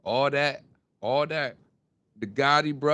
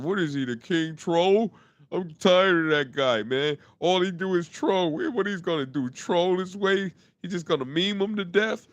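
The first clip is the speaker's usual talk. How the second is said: mock complaining haters; 2 semitones higher, 3 dB louder than usual. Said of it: "Everybody mad at [name] for laughing 'cause they said".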